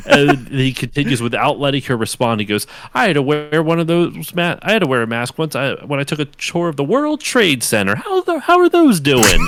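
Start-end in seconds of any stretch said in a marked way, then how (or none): 2.9-9.5 oblivious thawed man. Retold or imitated. Thawed man chatting about the past, mock oblivious. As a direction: play clueless small talk, ending high.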